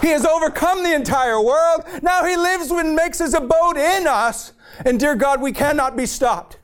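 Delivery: high, impassioned preacher's climb; fired-up preaching to the congregation. Real, not acted. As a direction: preach it at full force, pitch rising. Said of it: "I'm sorry I'm fired up".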